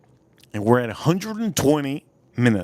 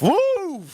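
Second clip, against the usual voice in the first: sudden high excited woo; high whoop. Real, not acted. Streamer cheering a super chat, high whoop. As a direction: break out in a high-pitched whoop.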